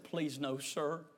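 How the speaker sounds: soft, low pleading; subdued tearful plea. Retold or imitated. Man begging to keep his car, subdued tearful plea. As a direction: plead quietly, close to tears.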